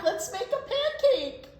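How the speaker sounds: high, loud eager call; eager plea. Acted. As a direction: beg for the pancake. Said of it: "shouted Jack".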